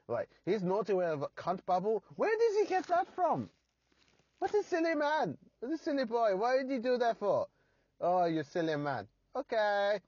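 very high, put-on German accent; German impersonation. The Germans visiting the podcast, in a high German accent. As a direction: ham up a silly German visitor voice. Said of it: "I was gonna do an impersonation".

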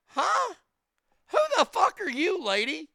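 high-pitched, incredulous mock outrage; indignant retort. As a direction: fire back at a meddling stranger, voice jumping up. Acts indignant retort to the Karen.

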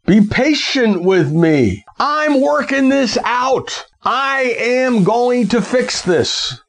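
high, drawn-out plea, stretching each word; desperate pleading. Acted. Debtor to his master, begging for time, pleading.

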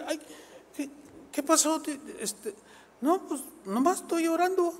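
high, whimpering wife voice; wife crying. Acted.

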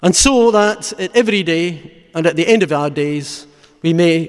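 measured, fervent sermon close; solemn preaching. Real, not acted. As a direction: preach it weightily, phrase by phrase, letting each one fall. Preaches a closing meditation on Christ's death.

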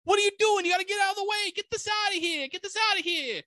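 very high, yelled New York cop caricature; New York accent. Retold or imitated. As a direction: order everyone away in a hammed-up New York accent. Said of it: "doing a New York accent for this".

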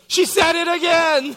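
put-on high, loud tattling kid voice; tattling little sister. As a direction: tell on her sister with glee. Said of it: "And Maddie says".

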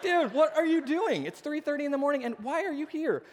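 high-pitched, incredulous questioning; comic exasperation, pitch way up; exasperated dad. Retold or imitated. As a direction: act out a bewildered parent in the middle of the night. Exasperated dad at half past three.

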